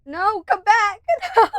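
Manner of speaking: high, loud laughing call after the cat. Real, not acted. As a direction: shout after the cat as it leaves. Calling the cat back.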